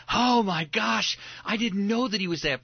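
high, loud astonished outburst; amazed gasp. Pretend. Synagogue listener hearing Ruth read, astonished. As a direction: gasp in sudden realization. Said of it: "you'd be like".

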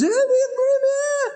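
very high, garbled holler; high-pitched mock shouting; imitated excited yell. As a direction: mimic someone yelling unclearly across a busy road. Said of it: "and he's yelling".

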